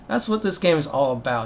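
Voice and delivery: ordinary talk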